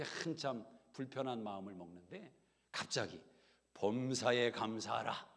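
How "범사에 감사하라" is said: slow, weighty 범사에 감사하라; solemn verse. Retold or imitated. Scripture verse coming to the wife's mind, slow and solemn. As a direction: recall the verse slowly and gravely. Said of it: "내 생각이 난 겁니다".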